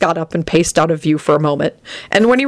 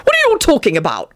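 fast, high-pitched demand; brisk, high challenge; 9 semitones higher, faster than usual. A narrator's reading. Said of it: "Arthur demanded as he stepped up".

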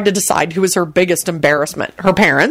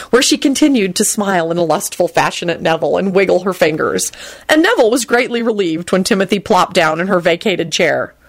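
ordinary delivery

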